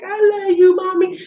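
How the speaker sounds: mock a kid saying I love you; a hint of a little girl's voice; high cutesy daughter voice. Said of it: "She's like".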